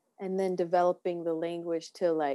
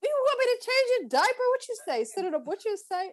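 much higher, hammed-up, teasing; mock baby talk; 11 semitones higher than usual. Mocking men in the sandbox with a high, teasing baby-talk voice. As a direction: talk down to a grown man like a toddler. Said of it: "I'm like".

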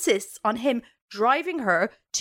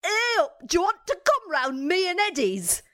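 higher, broad northern English [name]; [name] impression; 6 semitones higher than usual. Host as [name] inviting the guest round to Eddie's, mock Yorkshire. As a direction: lay the Yorkshire on thick. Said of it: "I need a translator".

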